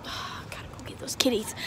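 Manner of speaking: unvoiced soft whisper. Real, not acted. Vlogger slipping off to fetch the kids, whispering. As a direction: whisper it as a quick aside.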